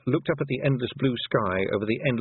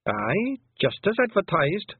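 high, slow Scottish pixie voice, untroubled; a wee Scots fighter shrugging off death; Scots pixie warrior; 10 semitones higher, much slower than usual. A narrator's reading. Rob Anybody shrugging off death to Tiffany, high Scots pixie voice.

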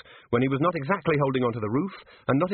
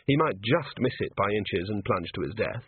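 ordinary delivery, nothing marked

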